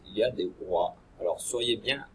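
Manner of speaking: usual speaking voice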